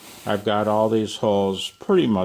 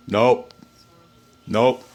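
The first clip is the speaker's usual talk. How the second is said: loud, rising nope; firm nope; 6 dB louder than usual. Acted. Man at his workbench refusing loudly in character. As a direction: refuse it flatly, playing along.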